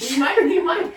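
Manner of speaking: laughing. Real, not acted. Playful echo with laugh.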